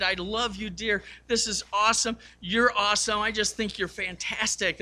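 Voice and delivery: tender praise. Retold or imitated. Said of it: "he kissed her head so gently".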